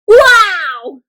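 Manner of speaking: high, loud, drawn-out cheer; big thrilled exclamation; excited and loud. Shown as a demonstration. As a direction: shout it with delight. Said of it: "very excitedly and loud".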